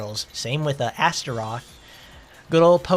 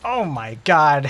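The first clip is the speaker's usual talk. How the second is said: shocked slow shout; slower than usual. Real, not acted. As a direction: cry it out, stretched and shocked. Shocked at game scene.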